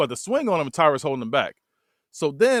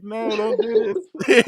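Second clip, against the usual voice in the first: 높고 느린 애원조로 흉내내며; "no, don't" stretched slow and high, begging; mock pleading; 7 semitones higher, 3 dB louder, much slower than usual.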